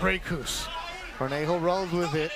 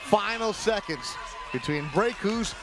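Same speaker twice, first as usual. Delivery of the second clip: hype announcer; 4 semitones higher than usual. Real, not acted. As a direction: stretch out the final seconds. Closing seconds call.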